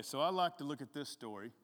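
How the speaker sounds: usual speaking voice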